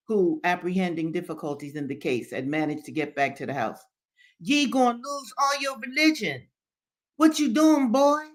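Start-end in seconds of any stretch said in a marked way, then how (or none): 4.4-8.2 put-on high-pitched, alarmed old man; interrogating Daddy Joe. A narrator's reading. Old man questions Henry about losing religion, interrogating. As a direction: scold him in a high, rising dialect voice. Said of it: "interrogated Daddy Joe".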